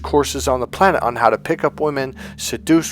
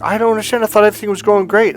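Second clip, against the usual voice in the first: mock bewildered man, pitched higher, rushed at the end; hammed-up confused ex-boyfriend; clueless dumped man; 8 semitones higher, 5 dB louder, much faster than usual. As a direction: play the baffled guy who never saw it coming. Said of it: "he's like".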